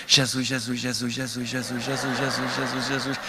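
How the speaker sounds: low, soft repeated prayer under the breath; murmured mantra. Retold or imitated. Visitor repeating his mantra before Chico, low murmured prayer. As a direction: chant the name softly to calm yourself. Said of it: "comecei o meu mantra".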